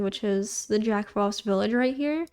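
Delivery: normal talking voice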